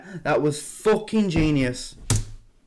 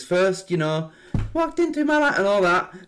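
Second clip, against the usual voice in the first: usual speaking voice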